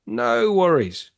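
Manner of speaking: drawn out and breezy like a carefree friend; easygoing mate. Acted. Scene: best friend answering the man stuck in the hole, breezy.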